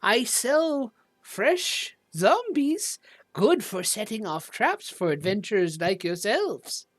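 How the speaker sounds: put-on high, swooping old lady selling her wares; Olive Stillwater. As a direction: play a welcoming old shopkeeper, voice leaping high. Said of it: "this old lady".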